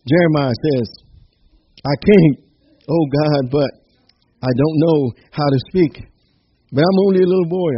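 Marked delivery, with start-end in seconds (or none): 1.8-7.7 lightly acted reluctant prophet, louder and higher; mock plea, pushed up in pitch and volume; protesting excuse. Reluctant prophet making excuses to God, mildly acted protest.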